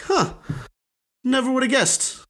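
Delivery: high, surprised musing; mock surprise. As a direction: act pleasantly stunned. Voicing own surprise.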